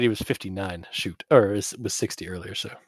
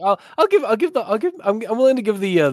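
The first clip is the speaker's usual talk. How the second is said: nervous high voice; 9 semitones higher, faster than usual. Acted. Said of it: "I was like".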